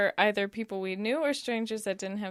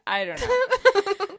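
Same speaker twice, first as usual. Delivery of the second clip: laughing; 11 semitones higher, 7 dB louder, much slower than usual. Real, not acted. Laughing at own dream.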